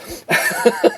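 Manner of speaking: short squeaky giggle at his own joke; high laugh. Real, not acted. Host giggling at his own joke, high and short. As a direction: let a high little giggle slip out.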